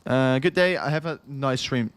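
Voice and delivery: ordinary delivery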